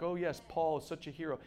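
soft, low mock admiration; understated mock reverence; sanitized pious agreement. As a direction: play the mild churchgoer, low and quiet. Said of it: "we so sanitize the Bible".

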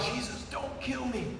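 a soft, struggling plea; terrified plea. Retold or imitated. Pinned under God's presence, begging Jesus in a soft terrified plea. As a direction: barely get the words out in fear. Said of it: "trying to get the words out".

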